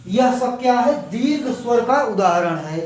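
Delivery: ordinary talk, nothing marked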